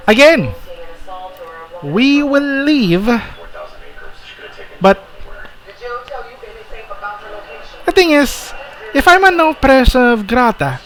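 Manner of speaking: high, affected character voice; protesting accused figure. Acted. Accused figure argues with the knights, high and protesting. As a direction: argue the case in a high put-on voice.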